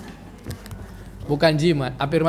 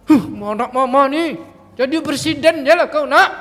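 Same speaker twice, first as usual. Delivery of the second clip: higher, louder mock scolding ending in a laugh; mock angry mother; 7 semitones higher, 4 dB louder than usual. Mother pretends to scold her child, mock angry and laughing. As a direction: scold the child but wish him well. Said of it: "kalau mau marah sama anak".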